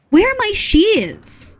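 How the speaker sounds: high, slow whine; high plaintive whine; whiny wail. Real, not acted. Searching again, wailing.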